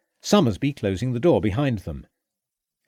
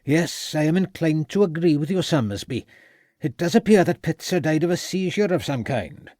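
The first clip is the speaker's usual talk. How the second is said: a hint of a Welsh lilt, a little higher; Welsh accent; 6 semitones higher than usual. A narrator's reading. The Welsh doctor speaks again.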